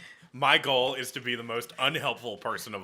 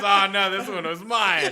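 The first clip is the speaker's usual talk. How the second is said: laughing mock innocence; 5 semitones higher, 5 dB louder than usual. Ben's prank line, retold with laughter.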